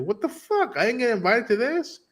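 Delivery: high, mock-offended squeal; indignant squeak. [name] passing the house, high mock outrage. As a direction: sound outraged at being left out.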